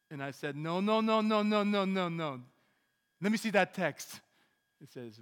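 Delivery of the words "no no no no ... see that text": loud, high, desperate no-no-no; panicked shouting refusal; frantic shouting. Retold or imitated. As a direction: shout it out in disbelief. Frantic refusal of cancelled flight.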